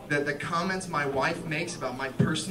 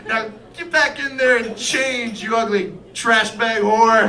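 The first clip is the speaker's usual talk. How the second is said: loud, raised, drawn-out insult; mock abusive yell; 4 semitones higher, 7 dB louder than usual. Husband cheerfully ordering wife to change, loud abuse. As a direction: belt out the insult with gusto.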